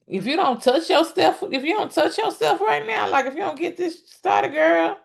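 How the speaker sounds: high, laughing mock voice of him; squeaky teasing imitation of a pushy man. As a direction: ham up his coaxing with a giggle. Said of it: "basically said".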